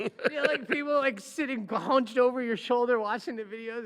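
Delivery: high and loud with glee; excited. Real, not acted. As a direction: paint the scene with excitement. Describing crowds watching DUI videos.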